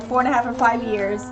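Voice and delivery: ordinary talk, nothing marked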